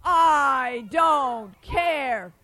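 loud, very slow giant voice; real loud. Shown as a demonstration. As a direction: shout it slowly for the kids. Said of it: "Let me hear that again real loud".

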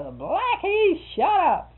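high, loud holler at a dog; retold yell. Owner hollers out at the barking dog to hush, yelling. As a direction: yell it sharply out into the yard. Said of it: "So I'll yell out there".

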